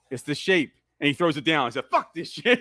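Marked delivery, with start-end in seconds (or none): 1.9-2.6 higher-pitched mock defiance; mock fed-up quote. Viewer voicing the killer throwing it down, mock fed-up. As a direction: blurt it as if fed up, half amused.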